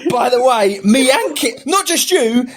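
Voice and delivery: rising, squeaky mocking read; mocking the message. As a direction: parody the friend's gloating text. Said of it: "this bullshit coming over saying".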